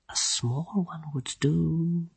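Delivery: slow soft mocking murmur; hushed dryad. A narrator's reading. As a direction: taunt him quietly. Druelai hushed.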